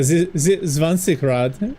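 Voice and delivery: mock German accent, stretching Zwanzigrad. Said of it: "that is my stereotypical German voice".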